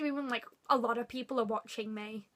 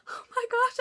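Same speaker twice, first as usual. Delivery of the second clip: high, louder squeal of delight; retold starstruck gasp; 12 semitones higher, 5 dB louder than usual. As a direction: act out being thrilled by the compliment. Re-enacting reaction to idol's compliment.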